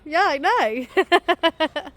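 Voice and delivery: loud, slow answer; drawn-out shout. Real, not acted. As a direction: call it back slowly. Replying loudly, drawn out.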